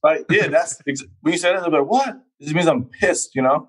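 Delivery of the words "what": high, rising questioning yelp; mock bafflement. Londoner imitating Americans baffled by slang, mock bafflement. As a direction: act out a baffled listener.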